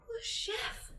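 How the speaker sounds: whispered, broken grief; grieving whisper. Acted. Woman whispering grief over the dead man. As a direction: breathe it out in grief.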